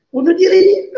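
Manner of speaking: high, laughing through pain; bitter laugh. Real, not acted.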